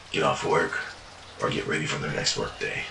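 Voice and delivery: ordinary delivery, nothing marked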